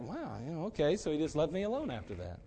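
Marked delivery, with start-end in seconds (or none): 0.0-1.0 subdued reaction. Retold or imitated. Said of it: "And he's like".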